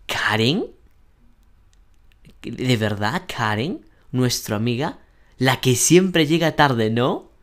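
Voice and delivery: each phrase ends on an upswing, up to a final rising "no"; rising questioning intonation. Shown as a demonstration. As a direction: lift the end of "Karen" as a doubting question. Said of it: "subes la entonación cuando buscas".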